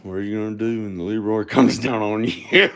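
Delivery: can't hold back a laugh while saying it; chuckling through the question. Real, not acted. Laughing through his own joke.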